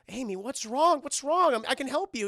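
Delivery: high, urgent imitation; acted worried boyfriend; concerned pleading. Date pleads to help distressed girl.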